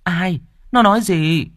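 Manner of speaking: high, urgent demand; pressing questions. A narrator's reading. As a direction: fire the questions insistently. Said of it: "Bà Hỏa hỏi dồn".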